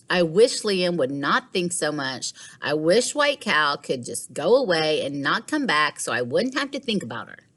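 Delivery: ordinary delivery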